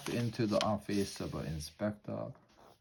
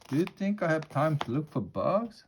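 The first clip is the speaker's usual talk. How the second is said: higher, louder irritated retort; a put-upon rat, voice raised; grumpy rat; 7 semitones higher, 5 dB louder than usual. A narrator's reading. Rat rebuffs the inspector's question, grumpy. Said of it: "What a grumpy rat".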